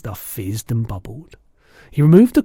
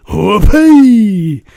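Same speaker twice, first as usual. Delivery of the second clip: high, very loud whoop; joyous whoop; 7 semitones higher, 13 dB louder than usual. A narrator's reading. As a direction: whoop with sheer joy. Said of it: "he cried".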